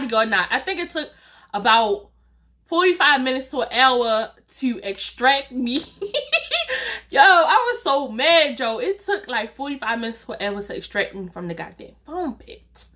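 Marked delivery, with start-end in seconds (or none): 5.8-7.0 real laugh bursting out, much higher; high burst of laughter. Real, not acted. Laughing at her own foam pit story, high real laugh. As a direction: crack up at the memory.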